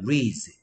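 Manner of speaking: "reads" as one smooth "reez"; model pronunciation. Shown as a demonstration. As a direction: say "reads" for repeating, with no D sound. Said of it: "자, 한번 따라해보자". ("reads"를 D 없이 '리즈'로 따라 하게 발음)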